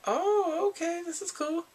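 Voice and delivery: high-pitched, approving coo; pleasantly impressed. Retold or imitated. Viewer hearing she would split the bill, high approving reaction. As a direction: lift it way up, approving.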